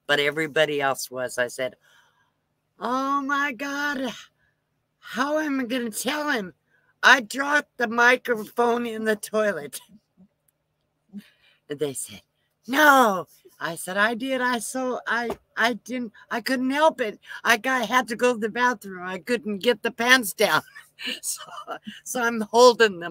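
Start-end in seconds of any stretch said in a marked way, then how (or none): 2.8-9.8 pretend-panicked wail; mock panic. Pranking crew about the microphone. 12.7-13.3 mock disbelief. Crew's shocked reply retold. 14.0-20.6 high, hammed-up pleading excuses; pretend-flustered explaining; mock distress. Keeps up fake confession.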